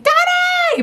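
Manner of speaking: very high, loud drawn-out call; squealed mom call-out; mock yell at child. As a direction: holler it up high, not really scolding. Calls out to her son Adonis.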